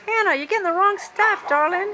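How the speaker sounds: high, loud, quick tease; playful scolding. Real, not acted. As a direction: tease her brightly. Teasing child about candy.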